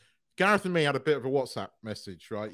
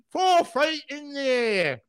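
high, slow Cockney imitation of Troy; mock Cockney accent; 10 semitones higher, slower than usual. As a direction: ham up a Cockney lilt, drawn out. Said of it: "switched from American to Cockney".

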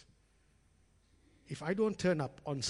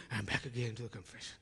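fast, softer, lower tired sigh of a line; weary Luther; 6 semitones lower, 9 dB quieter, faster than usual. Retold or imitated. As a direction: mutter it like someone dragged back again.

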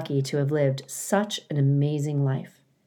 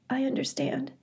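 sniffling; 9 semitones higher, 8 dB quieter than usual. A narrator's reading. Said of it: "sniffled the goose".

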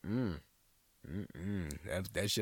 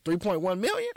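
surprised; 11 semitones higher, 6 dB louder than usual. Real, not acted. Disbelief at the price.

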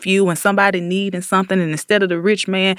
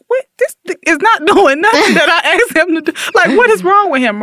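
much higher, louder, laughing disbelief; mock baffled David; 11 semitones higher, 3 dB louder than usual. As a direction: squeal out the king's frustration through a laugh. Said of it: "David was like".

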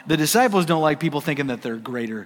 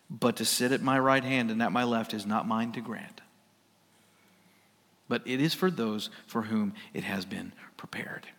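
hushed Jesus quote; 7 semitones lower, 9 dB quieter than usual. Acted. Jesus quoted softly in sermon.

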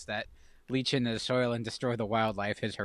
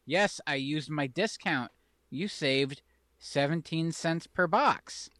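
slow, proud explanation; proud friend; much slower than usual. Retold or imitated. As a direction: spell it out slowly and proudly. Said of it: "He's like".

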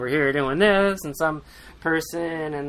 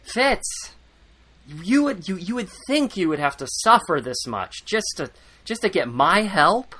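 angry scolding; 6 semitones higher than usual. Retold or imitated. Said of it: "Chade gets pissed".

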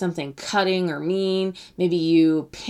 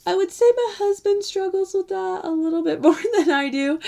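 laughing aside; 12 semitones higher than usual. Real, not acted. Teasing aside about husband.

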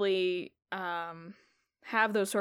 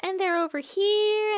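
high NPC voice; 10 semitones higher, 4 dB louder than usual. Acted. NPC points repeatedly.

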